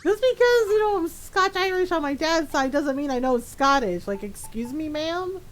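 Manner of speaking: high, mock-affronted comeback; indignant retort. Retelling her comeback to a friend, indignant. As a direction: start high and protesting, end on a questioning rise.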